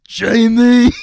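very loud action-hero impersonation; hammed-up big-man call; Arnold Schwarzenegger. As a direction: boom the name out heroically. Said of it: "Arnold just goes".

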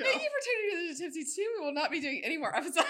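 high, loud, fast through giggles; laughing sign-off. Pretend. Co-host jokingly ending the podcast for good, laughing hard. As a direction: rush a mock announcement while cracking up.

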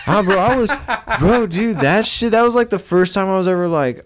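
high, loud, laughing burst; laughing excitement. Real, not acted. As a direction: blurt it out through laughter.

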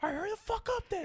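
fast, high mock impatient dad. Son imitating his impatient dad, fast and high. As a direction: rush it like an annoyed father.

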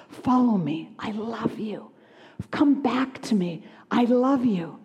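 God pleading in a whisper; tender whisper. Acted. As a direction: whisper come back to me, tenderly. God voiced pleading with people.

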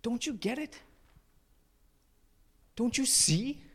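high, pleading exasperation; frustrated rabbi. Acted. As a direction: implore them to understand, rising at the end. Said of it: "he's frustrated".

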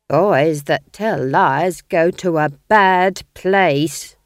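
very slow, loud nanny warning. A narrator's reading. Nanny Og warning the ghost king, slow and loud. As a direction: warn him like a stern nursery nurse. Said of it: "warned Nanny".

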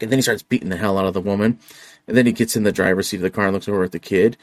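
ordinary talk, nothing marked

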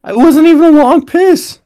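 high, loud disbelief; indignant outburst. Real, not acted. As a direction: exclaim it in outrage.

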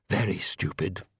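understated sighing admission; sighed regret. A narrator's reading. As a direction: let the last words drop away, resigned. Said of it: "he sighed".

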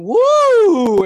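high, loud mock cheer; cheering fans. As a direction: whoop like excited fans in the stands. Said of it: "four middle-aged women going".

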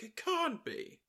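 a stunned imitation of Cruella; shocked Cruella quote. Cruella noticing them, quoted.

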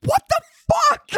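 high-pitched mock yell; squawked yell of alarm; retold yell. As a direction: re-enact a startled outburst, voice leaping way up. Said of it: "I just yelled".

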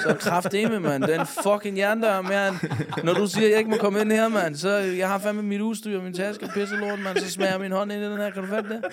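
high, loud furious rant; drunken rage. Retold or imitated. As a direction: rage at the iron door. Acted drunken rage at the door.

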